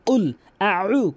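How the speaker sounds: কুল, আউ with a plain আউ, the wrong way; wrong Qul au. Shown as a demonstration. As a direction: say আউ lazily, without weight. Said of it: "কখনোই আউ বলবেন না". (ভুল উচ্চারণে আউ)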